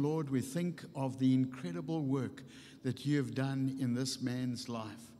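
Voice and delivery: ordinary talk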